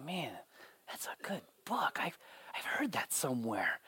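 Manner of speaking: unvoiced hushed whisper; soft whisper. To her husband, a dazed whispered half-recognition. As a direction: breathe it like a fragile memory. Wife in Notebook story.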